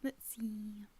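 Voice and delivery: ordinary delivery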